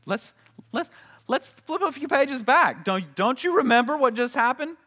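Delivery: exasperated scolding. Retold or imitated. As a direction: reader shouting into the book at Israel. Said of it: "choke them and say".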